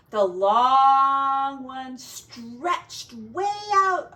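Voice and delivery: drawn out long and slow, like a worm stretching; stretched out slowly. A narrator's reading. Storyteller stretching words as Measuring Worm reaches across the ridges.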